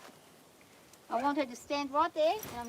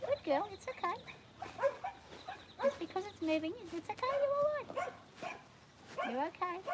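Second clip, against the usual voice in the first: higher, softer soothing voice; reassuring the horse; 8 semitones higher, 4 dB quieter than usual. Real, not acted. Trainer reassuring her worried horse, higher and soft. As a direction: coo her fears away gently.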